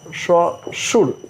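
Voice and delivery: स्वशुर said slowly, each sound drawn out; careful pronunciation. Shown as a demonstration. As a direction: spell out स्वशुर syllable by syllable. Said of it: "ध्यान देना". (स्वशुर धीरे-धीरे साफ़ उच्चारण)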